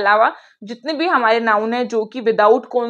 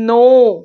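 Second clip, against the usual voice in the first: a louder, emphatic "No"; firm no; 7 dB louder than usual. Shown as a demonstration. Teacher to students, emphatic louder no. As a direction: shut the idea down firmly. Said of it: "firm correction to class".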